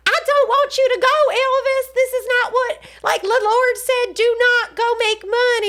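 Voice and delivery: high-pitched, hammed-up Southern mama. Mother forbidding her son to tour, high Southern wail. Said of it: "This is what her voice sounded like".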